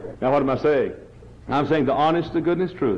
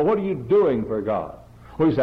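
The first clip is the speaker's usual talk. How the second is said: ordinary talk, nothing marked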